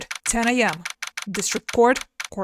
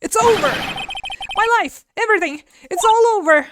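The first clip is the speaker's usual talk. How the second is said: despairing wail; 8 semitones higher, 3 dB louder, faster than usual. Acted. Client screaming over there.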